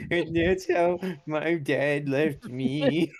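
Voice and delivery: mock sad confession. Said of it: "He's like".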